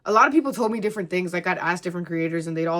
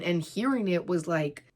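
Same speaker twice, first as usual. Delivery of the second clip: ordinary talk, nothing marked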